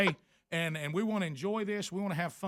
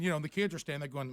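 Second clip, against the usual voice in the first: normal talking voice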